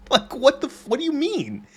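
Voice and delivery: high-pitched, incredulous outburst; baffled, cut-off swear into a pleading question; exasperated bafflement. Acted. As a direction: voice jumps up in disbelief. Baffled reaction to bizarre character.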